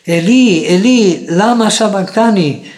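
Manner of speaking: high, loud anguished cry, drawn out slowly. A narrator's reading. Jesus crying out to God from the cross, loud slow cry. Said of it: "clamó a gran voz".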